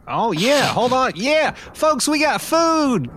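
high, excited holler to the group; calling out. Acted. Calling folks over to food, excited holler.

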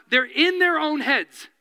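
usual speaking voice

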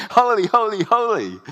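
loud, high holy, holy, holy; mock worshipper shouting holy; worship cry. Mimicking the worship meeting's cry.

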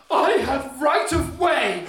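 very high, loud and slow, indignant; mock indignant shout. Old fella leaning in to the young fella, loud and slow. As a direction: bellow your right of way slowly. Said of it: "leans in ... and goes".

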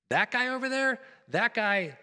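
panicked Peter cursing across the fire; cursing denial. Retold or imitated. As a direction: point and curse in a panic. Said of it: "calling down anathemas and curses on Jesus".

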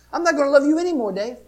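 high fast playful warning; mock God voice. God teasing Dave in a high, quick voice.

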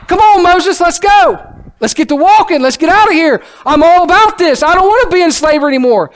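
excited crowd urging Moses to lead them out; eager Israelites. Retold or imitated. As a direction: cheer Moses on like freed slaves ready to go. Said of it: "They were like".

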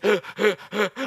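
exaggerated labored panting; dying gasps. Comedian at the same pace as runner, dying pants. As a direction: gasp as if about to collapse. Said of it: "I'm dying".

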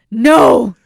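a loud barked "No."; mock panicked loud no. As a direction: shout it in panic. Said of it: "And he goes".

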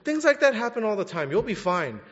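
a hint of a dismissive mother; mimicking mom's brush-off. Son retelling his mom's phone reply, mock dismissive. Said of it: "a terrible comforter".

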